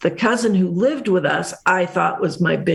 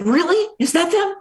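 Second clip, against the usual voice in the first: higher-pitched mock astonishment; amazed relative; 6 semitones higher than usual. Relative spotting the Hollywood cousins at a party, amazed. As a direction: imitate a man's incredulous recognition.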